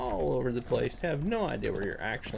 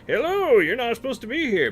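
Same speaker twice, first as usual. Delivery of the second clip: much higher and louder, faster, hammed-up; exaggerated NPC imitation; 11 semitones higher, 6 dB louder than usual. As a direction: overplay the tired NPC greeting. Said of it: "you click on him".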